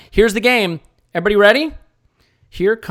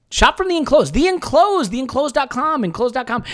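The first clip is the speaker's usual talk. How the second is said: hammed-up ad read; 6 semitones higher than usual. Sponsor plug in podcast.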